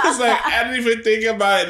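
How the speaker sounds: high, loud, laughing; laughing retell. Retold or imitated. Retelling the slip to her cohost, laughing. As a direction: still cracking up over it.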